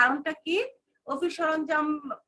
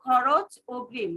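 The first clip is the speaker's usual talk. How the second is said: slow, for writing down; slow dictation; slower than usual. Shown as a demonstration. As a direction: dictate it slowly. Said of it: "খাতায় star চিহ্ন দিয়ে লিখে রাখো". (ধীরে ধীরে লিখিয়ে বলা)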